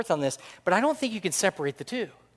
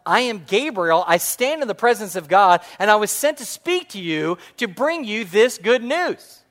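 loud, raised angelic proclamation; Gabriel; 5 semitones higher, 7 dB louder than usual. Acted. Angel answers doubting Zechariah, loud indignant proclamation. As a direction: announce it with mounting force. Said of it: "Gabriel's response".

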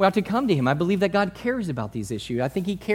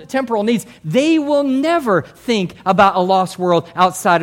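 loud, driving sermon voice; fiery preaching; 4 semitones higher, 6 dB louder than usual. Real, not acted. Preacher warning the church, loud and driving. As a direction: press the warning hard at full force.

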